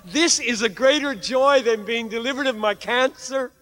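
high, loud, impassioned sermon climax; fervent preaching. Acted. Preacher urging hearers to embrace pain for God, fervent full-force preaching.

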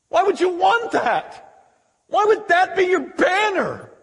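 high, urgent challenge; exasperated pleading. Acted. Father challenging son's pride in abstinence, exasperated plea. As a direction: press him with disbelieving questions. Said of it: "he begs him".

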